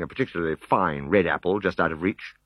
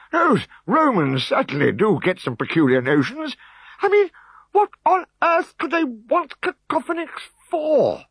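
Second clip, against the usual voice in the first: high, louder indignant chief; higher and louder, ever more indignant; worked up; 4 dB louder, slower than usual. A narrator's reading. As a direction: fuss himself into a lather. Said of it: "He was getting quite worked up".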